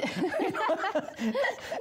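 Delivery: high-pitched laughing burst; laughter. Real, not acted. Laughs after repeating the advice about names.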